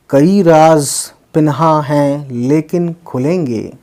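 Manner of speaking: slow, measured verse with pauses between phrases; ghazal recitation. Acted. Vlogger reciting a Miraji ghazal to his viewers, measured poetry recitation. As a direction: recite each couplet deliberately, falling on the refrain.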